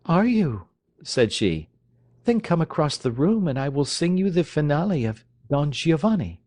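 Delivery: high, coaxing teasing voice; playful young lady. Acted. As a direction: lure him over playfully.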